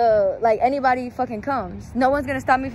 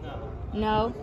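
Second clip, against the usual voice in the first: normal talking voice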